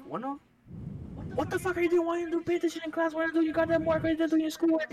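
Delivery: high, loud, rapid-fire mock mom; imitated scolding mom. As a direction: nag shrilly in imitation of an angry mother. Acts out mom scolding on phone.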